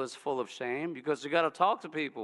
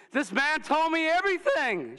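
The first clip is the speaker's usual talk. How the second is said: high, loud joyful exclaim; excited; 12 semitones higher, 5 dB louder than usual. Acted. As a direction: burst out in delight.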